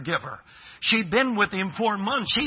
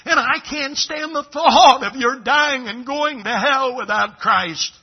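pleading through tears, louder and higher; tearful plea; 6 semitones higher, 6 dB louder than usual. Retold or imitated. A woman weeping at a dying man's bedside, begging him. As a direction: cry out your love for him as if weeping. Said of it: "she began to weep".

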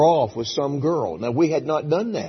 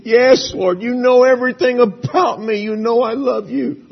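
grieving plea; 7 semitones higher, 6 dB louder than usual. Acted. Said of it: "he starts to weep".

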